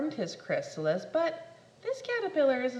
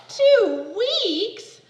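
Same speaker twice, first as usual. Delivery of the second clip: much higher and louder two weeks; aghast at the wait; loud disbelieving squeak; shocked question; 10 semitones higher, 11 dB louder than usual. Acted. Shocked caterpillar.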